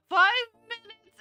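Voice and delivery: real alarm, loud and drawn out; shocked. Real, not acted. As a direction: gasp it out in disbelief. Sees the redeem timer.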